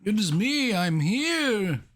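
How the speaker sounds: high, slow sing-song goofing; playful high-pitched greeting; silly high voice. Pretend. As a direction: announce yourself in a silly high voice.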